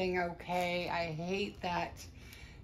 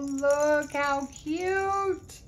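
high, loud and slow with delight; gushing; 7 semitones higher, 6 dB louder, much slower than usual. Real, not acted. Vlogger showing viewers the toy, high delighted gush. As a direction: stretch it out in delight.

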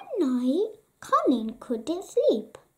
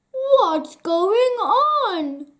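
loud, slow, alarmed king; king crying out; 6 semitones higher, 6 dB louder, much slower than usual. A narrator's reading. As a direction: call out in alarm. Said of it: "cried the king".

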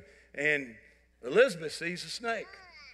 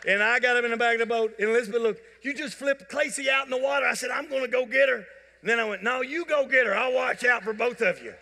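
excited; 6 semitones higher, 5 dB louder than usual. Real, not acted. Climax of snake story, laughing.

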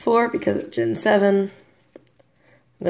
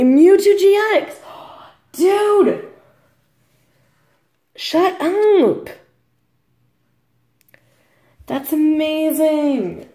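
high, loud squeal of excitement; real thrilled outburst, stretching the words; ecstatic disbelief; 9 semitones higher, 4 dB louder, much slower than usual. Real, not acted. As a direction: voice leaps way up, stunned by the pull. Ecstatic at pulling a rare card.

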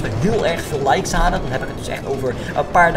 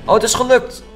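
high, loud relieved cheer; 10 semitones higher, 5 dB louder than usual. Real, not acted. Ride succeeds.

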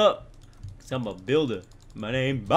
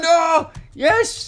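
high, loud cry of relief; excited yell; 12 semitones higher, 8 dB louder than usual. Real, not acted. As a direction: yell it as the jump lands. Clears the jump.

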